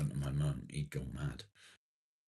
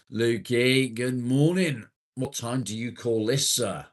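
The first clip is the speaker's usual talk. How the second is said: high, loud mock telling-off; loud teasing greeting; 6 semitones higher, 7 dB louder than usual. Real, not acted. Streamer greeting a late viewer, loud mock scolding. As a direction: call out to a latecomer in jest.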